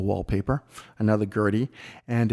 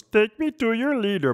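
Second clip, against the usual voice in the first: high, strange alien voice; a squeaky sci-fi alien demanding the leader; 12 semitones higher than usual. Acted. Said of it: "and the alien goes".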